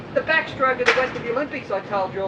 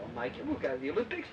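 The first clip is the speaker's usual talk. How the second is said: much lower, much softer promise; quiet reassurance; 6 semitones lower, 10 dB quieter, faster than usual. Retold or imitated. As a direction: reassure him gently, low and soft. Coach reassures swimmer quietly.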